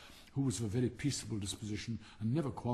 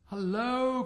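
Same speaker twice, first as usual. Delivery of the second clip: much higher and louder hello; calling out; 12 semitones higher, 6 dB louder than usual. Acted. Boy calling out on spotting the stick. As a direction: hail a find with a cry. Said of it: "cried one of the boys".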